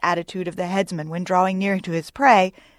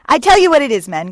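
loud, high cry to the crew; raised urgent captain's shout; Starbuck; 6 semitones higher, 9 dB louder than usual. A narrator's reading. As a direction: call out to rally the men. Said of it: "cried Starbuck to his crew".

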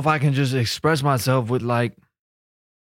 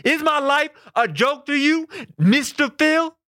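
much higher, slow, wounded cry; offended high outburst; 10 semitones higher, slower than usual. Acted. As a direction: rise up high in mock hurt.

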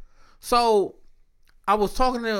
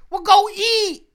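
exasperated retort; 13 semitones higher, 8 dB louder, slower than usual. Real, not acted.

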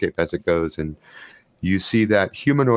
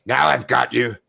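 strange, garbled voice from the dark; 3 semitones higher than usual. Acted. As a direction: speak it warped and inhuman. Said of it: "a strange, garbled voice that says".

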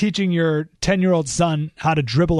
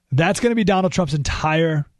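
ordinary delivery

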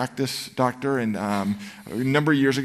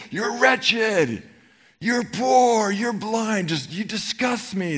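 much higher, loud shouted "wretched"; harsh condemnation; 10 semitones higher, 3 dB louder, much slower than usual. Shown as a demonstration. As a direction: bark it down from on high in contempt. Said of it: "This isn't Jesus high and lifted up going".

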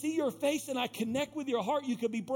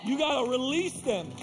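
louder, higher, slow release cry; full-force preaching; 5 semitones higher, 4 dB louder, slower than usual. Acted. Pastor urging the church to release the people who rejected them. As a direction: preach it out, drawing out each release.

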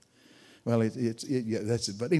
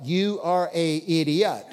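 slow, lightly voiced scornful stare; mock scornful look; 3 semitones higher, slower than usual. Preacher mimicking the gang boss's doubtful look, slow and mocking. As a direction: drag it out like an unimpressed boss. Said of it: "He looked at me like".

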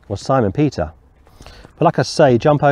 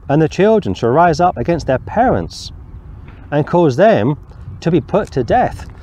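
emphatic scripture reading; 4 semitones higher than usual. Acted. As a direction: proclaim the verse higher. Reads scripture aloud.

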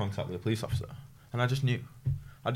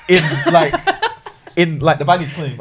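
laugh-cracked and louder, amused disbelief; laughing through it; 6 semitones higher, 10 dB louder than usual. Retold or imitated. To the podcast hosts, laughing about his colleague's innocence. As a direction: let the giggles break the words.

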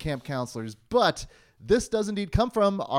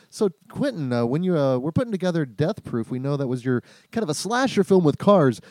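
eager interviewer, building louder and higher; mock interviewer; 4 semitones higher, 6 dB louder than usual. Interviewer asks Quentin his favorite slasher, loud and eager. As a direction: build to loud, high-pitched questions.

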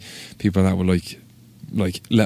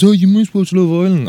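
high-pitched, mocking know-it-all voice; mocking imitation; 11 semitones higher, 3 dB louder than usual. Someone assuming he watches Love Island, high mocking imitation. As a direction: mimic the annoying assumption. Mimics someone who teased him.

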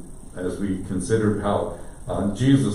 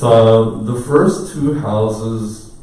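ordinary talk, nothing marked

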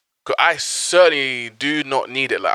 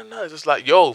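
ordinary talk, nothing marked

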